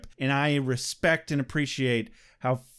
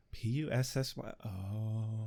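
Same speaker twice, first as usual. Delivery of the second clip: quiet dawning realization; 5 semitones lower, 11 dB quieter than usual. Retold or imitated. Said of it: "I was like".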